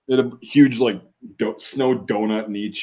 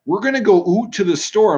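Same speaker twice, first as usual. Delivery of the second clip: put-on Canadian accent; 10 semitones higher than usual. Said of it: "And they're like".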